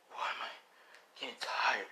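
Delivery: slow, drained whisper; acted weary murmur; tired bewilderment. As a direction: breathe it out, puzzled and fading. Hero puzzled by sudden fatigue.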